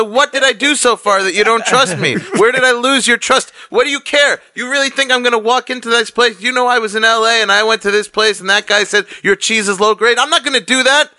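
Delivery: high-pitched, indignant retold argument; exasperated protest. Customer demanding pizza man name the place, exasperated protest.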